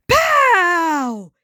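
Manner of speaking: thrilled shout of recognition; excited exclaim. A narrator's reading. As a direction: Ren cries out with joy. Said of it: "he exclaimed".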